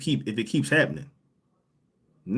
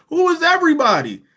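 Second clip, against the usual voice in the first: high, loud laughing repeat of the question; laughing disbelief; 13 semitones higher, 6 dB louder than usual. Real, not acted. To the guest who claims fans said it, laughing disbelief. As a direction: crack up while asking it again.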